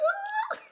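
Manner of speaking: high laughing shriek at the word tumor; a startled shriek dissolving into giggles; mock shriek. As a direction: squeal it then break into laughter. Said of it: "I was like".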